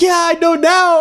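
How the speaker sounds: imitating mom, high and worked-up; high-pitched, protesting concession; defensive. Mom admitting the scam to her son, defensive.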